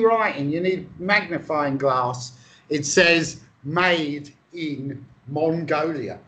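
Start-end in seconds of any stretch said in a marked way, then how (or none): 3.6-6.2 slow, deliberate reading out; measured, drawn-out label reading; reading an inscription. Acted. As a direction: spell out the label word by word, slowly. Reading out the tiny writing.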